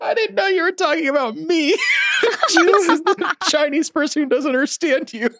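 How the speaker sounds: high-pitched talking through laughter; laughing hard. Real, not acted. Teased friend protesting to the joker, laughing. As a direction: barely get the words out for laughing.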